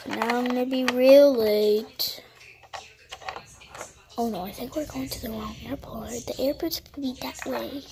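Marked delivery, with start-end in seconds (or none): 4.2-7.8 fast whispered worry, kept secret from the driver; urgent whisper. Pretend. Passenger whispering to herself about the wrong airport, hushed and quick. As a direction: hurry it out under your breath. Noticing the taxi goes wrong.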